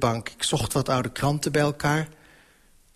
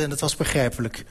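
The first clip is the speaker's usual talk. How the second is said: plain reading voice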